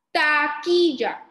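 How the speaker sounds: "Taquilla" slowly as TA-KI-LLA, loud; teacher's slow, loud "Taquilla", syllables apart. Shown as a demonstration. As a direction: sound out "Taquilla" in three stretched beats. Teacher sounds out word by syllables.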